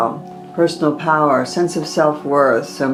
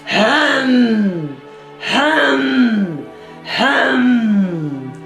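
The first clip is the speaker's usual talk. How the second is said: much higher forceful Hem exhales; lion breath; 8 semitones higher, much slower than usual. Shown as a demonstration. Teacher leading the throat chakra lion breath, forceful. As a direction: roar it out like a lion. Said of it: "we use the lion breath".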